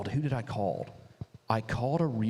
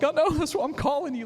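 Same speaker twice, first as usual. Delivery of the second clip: nervous laugh; 5 dB louder, faster than usual. Retold or imitated. Retelling a nervous call to lawyer.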